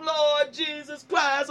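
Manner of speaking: normal talking voice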